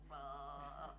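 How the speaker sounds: low imitated stomach gurgle "Bah"; stomach noise. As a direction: mimic a rumbling belly, low and soft. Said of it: "my stomach was like".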